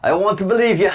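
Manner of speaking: high, loud mock-Scottish plea, sliding into Cockney; bad Scottish accent. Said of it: "that was Cockney. That was, that was bad".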